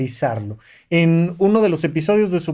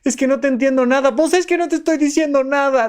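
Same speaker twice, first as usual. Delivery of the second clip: put-on Chabelo's child gag; 9 semitones higher, faster than usual. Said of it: "Chabelo ... un gag clásico".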